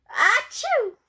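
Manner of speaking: high-pitched pretend sneeze; acted sneeze. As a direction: pop out a theatrical "achoo". Character sneezes in the story.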